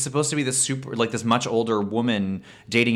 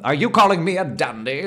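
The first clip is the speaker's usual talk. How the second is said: higher, put-on British challenge; mock British indignation; 5 semitones higher, 4 dB louder than usual. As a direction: act the affronted British gent. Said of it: "the British equivalent of, like, hulking out".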